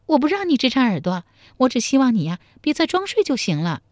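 high woman's voice, mildly amused; high, lightly amused reproach; suppressed amusement. A narrator's reading. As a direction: hold back a laugh while chiding. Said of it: "他强忍住笑说".

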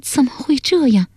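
high-pitched puzzled daughter; bewildered murmur. A narrator's reading. Daughter confused and troubled, bewildered murmuring. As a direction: wonder to yourself in a high voice. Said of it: "喃喃地说".

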